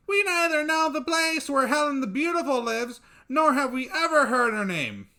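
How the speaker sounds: very high, loud chirping voice; tiny creatures answering together; chorus of birds. Acted. Birds answering their king, high and piping.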